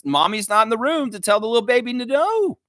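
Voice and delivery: high, strained mock outcry; mock exasperation. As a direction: climb high and wail it in mock dismay. Mock lament about absent mommy.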